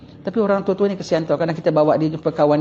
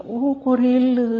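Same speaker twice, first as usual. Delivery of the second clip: slow, higher mocking echo; sarcastic oh; 5 semitones higher, much slower than usual. Preacher mocks a son who would let his mother die, slow sarcastic echo. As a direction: drag it out in slow, high disbelief.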